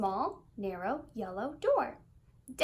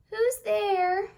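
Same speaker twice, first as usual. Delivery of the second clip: high, loud, squeaky little voice; a small sad squeaky voice behind the door; 9 semitones higher, 7 dB louder than usual. A narrator's reading. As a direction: squeak it out sadly. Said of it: "a sad, squeaky sort of voice".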